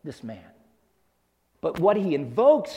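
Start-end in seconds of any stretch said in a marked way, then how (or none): 0.0-0.4 low, soft, detached echo; impersonal. Retold or imitated. Preacher echoing Peter's denial of Jesus, low and impersonal. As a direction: drop it low and quiet, impersonally. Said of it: "he speaks of him so impersonally".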